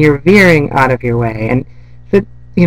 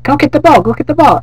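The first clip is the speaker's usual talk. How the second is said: much higher, louder coaxing call; excited dog command; 14 semitones higher, 5 dB louder, faster than usual. Retold or imitated. Lois hyping up the dog to chase the ball. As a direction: hype up a dog to fetch. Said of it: "And she's like".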